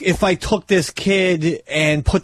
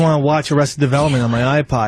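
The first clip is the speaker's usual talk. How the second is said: ordinary talk, nothing marked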